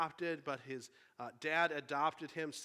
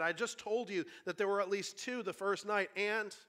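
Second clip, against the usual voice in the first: higher, insistent arguing; indignant protest; 6 semitones higher, 4 dB louder than usual. A narrator's reading. Shasta disputes the voice's count of lions, indignant. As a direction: argue back like an exasperated boy, then break off.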